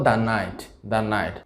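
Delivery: "the night" spoken "da night"; short a in "the", then night. Shown as a demonstration. Teacher models da night for learners. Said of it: "আমরা উচ্চারণ করব দা".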